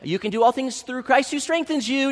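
lightly hammed-up churchy encouragement; mild mock churchy uplift. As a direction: quote the verse like a preachy bystander. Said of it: "they get real churchy".

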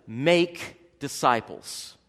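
"Make disciples" slow and weighty, hitting "Make"; stress on make disciples. Shown as a demonstration. Preacher slowly stresses "Make disciples" as the passage's point. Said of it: "the emphasis".

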